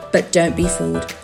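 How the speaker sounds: normal talking voice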